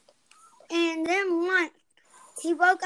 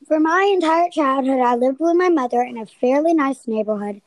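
loud dramatic story reading; 10 dB louder than usual. Acted.

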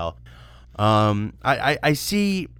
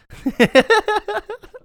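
high burst of laughter; 7 dB louder than usual. Real, not acted. Laughs at own joke.

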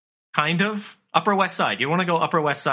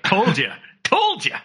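teasing singsong, second one much higher; mock gloating; 6 semitones higher, 3 dB louder, slower than usual. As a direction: rub it in playfully. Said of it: "and be like".

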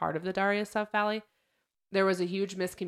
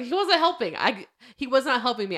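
high, louder exasperated defense; indignant protest; 7 semitones higher, 5 dB louder than usual. Retold or imitated. Soldier defending himself to the colonel, indignant and high. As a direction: insist you were right, worked up. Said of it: "And he's like".

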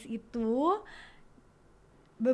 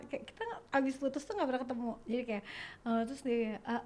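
normal talking voice

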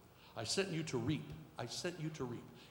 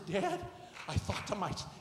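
ordinary delivery

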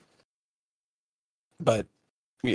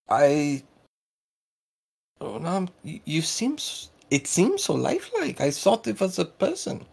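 put-on higher-pitched, stammering German-accented voice; flustered accented character; 7 semitones higher than usual. Local apologizing to the party for a mistake, flustered in Russo-German accent.

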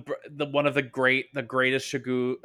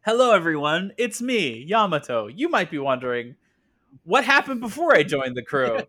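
bright-pitched, loud narrator introducing themself; high, sing-out mock narration; Yamato; 4 semitones higher, 5 dB louder than usual. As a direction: greet the audience like a peppy storyteller. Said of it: "put Yamato's voice in there".

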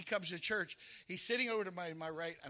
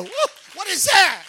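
high, loud, startled wonder; a sinner stunned as he walks into church; amazed whoa; 11 semitones higher, 19 dB louder than usual. Retold or imitated. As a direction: act out being struck by something unseen. Sinner feeling God's presence.

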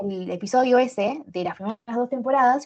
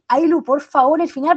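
excited plea; 5 semitones higher, 4 dB louder than usual. Retold or imitated.

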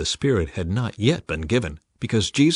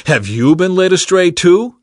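higher, louder mocking question; 8 semitones higher, 9 dB louder than usual. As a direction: scorn the guards. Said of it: "the Pharisees mocked".

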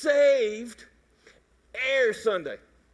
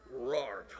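very low, soft mock roar; playful lion roar; low growl; 10 semitones lower, 8 dB quieter than usual. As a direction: growl it under your breath.

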